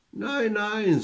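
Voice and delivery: lightly voiced old woman, soothing denial; old woman protesting. A narrator's reading.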